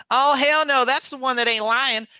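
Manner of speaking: mock sassy outburst; indignant mom shout. Mom defending him to the cops, high and loud. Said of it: "She comes out and goes".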